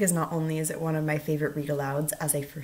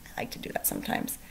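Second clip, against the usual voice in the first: 1 quick whispered aside; 9 dB quieter, faster than usual. Real, not acted.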